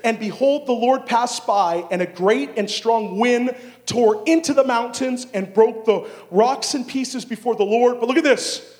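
loud, high, drawn-out scripture reading; full-force preaching. Acted. Preacher reads of the great wind to the congregation, full-force preaching. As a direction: proclaim the passage with building force.

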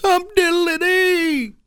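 very high, slow singsong echo; mock celebration. Co-host teasing frustrated host with montage quote, mock celebration. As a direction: parrot the catchphrase in a drawn-out high voice. Co-host echoes the sarcastic catchphrase.

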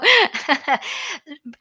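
a burst of amused laughter; real laugh. Real, not acted. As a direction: laugh out at your own joke. Laughs at his own joke.

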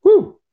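high loud startled whoop. Real, not acted. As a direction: yelp after jolting awake. Startled awake driving.

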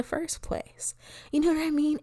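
unvoiced confiding whisper on the shame. Real, not acted. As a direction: breathe it out as a secret. Whispered aside about shame.

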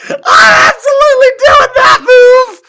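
screaming it out, thrilled; excited shout. Real, not acted. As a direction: yell with cracking excitement. Excited yelling about the move.

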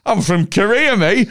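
high, hammed-up fake Korean accent; overdone put-on Korean accent for a laugh; mock Korean accent. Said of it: "you can't be like".

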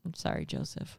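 hushed whispered apology. Real, not acted. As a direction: breathe it out, barely voiced. Mock apology to baby, whispered.

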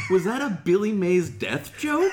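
laughing, shocked. Real, not acted. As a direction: laugh through the question.

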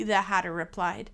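ordinary delivery

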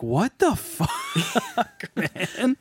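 very slow, high, laughing disbelief; shocked laughing what the fuck. Retold or imitated. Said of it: "so rough that I was like".

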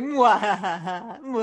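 slow, evil villain cackle; maniacal villain laugh. Retold or imitated. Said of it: "maniacal villain laugh".

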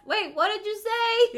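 high, loud, indignant granny voice; hammed-up deaf grandma; confused old lady. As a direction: squawk like a puzzled grandma. Imitating deaf elderly self.

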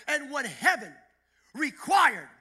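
loud, high, building to JESUS SHOWED UP; full revival shout; preaching at full force. Real, not acted. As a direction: preach it to the rafters, word by word. Climactic altar-call preaching.

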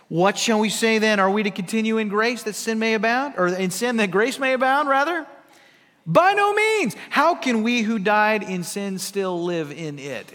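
building to a ringing by no means; fervent scripture reading. Acted. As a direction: read Paul like a rallying cry. Reads scripture with rising force.

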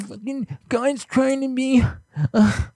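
mock the online critics; mocking hater voice. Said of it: "They're like".

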